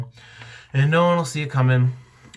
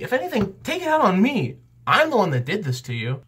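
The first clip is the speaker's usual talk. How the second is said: much higher, protesting; indignant; 8 semitones higher, faster than usual. Real, not acted. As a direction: rise up defending the waitress. Indignant defending waitress.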